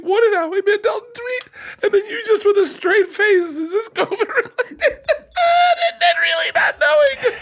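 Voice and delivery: high squeaky voice, sobbing with laughter; crying with laughter. Real, not acted. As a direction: laugh so hard the voice cracks up high. Host crying with laughter, high-pitched.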